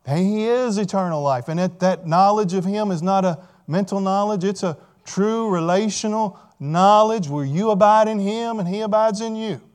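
ordinary talk, nothing marked

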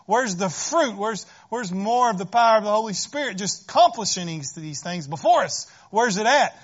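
loud, insistent questioning; impatient demand. Pretend. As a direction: demand answers, pressing harder. Voicing impatient demand for fruit.

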